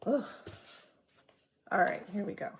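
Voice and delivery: normal talking voice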